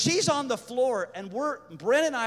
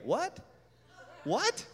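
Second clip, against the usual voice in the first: rising, high-pitched double take; disbelieving what; 5 semitones higher, 4 dB quieter than usual. Retold or imitated. Storyteller told of the check, disbelieving what. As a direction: ask it high, sharply rising, stunned.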